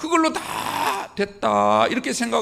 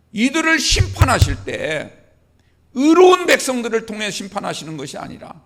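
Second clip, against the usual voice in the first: Habakkuk crying out; 3 semitones higher, 4 dB louder than usual. Retold or imitated. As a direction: cry out in bewildered protest, punching key words. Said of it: "하나님 앞에 다시 부르짖습니다". (다시 부르짖는 하박국을 흉내 낸 큰 목소리)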